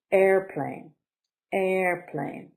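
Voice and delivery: airplane with AIR stretched and strong, plane falling; teacher's clear model of airplane; stress on first syllable. Shown as a demonstration. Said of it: "Stress is on the first".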